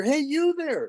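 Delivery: high-pitched bird calling out; goldfinch calling. Acted. Unseen goldfinch to man weed whacking, high-pitched calling. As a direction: pitch it way up, a sudden call.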